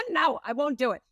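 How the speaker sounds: high, laughing no as if digging in heels; laughing refusal. Retold or imitated. When people told her what to do, laughing high refusal. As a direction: refuse it with a laugh, pitch jumping up. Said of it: "I was like".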